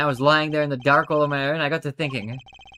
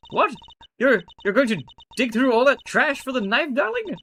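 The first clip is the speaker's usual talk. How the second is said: shocked lady voice; 7 semitones higher than usual. Acted.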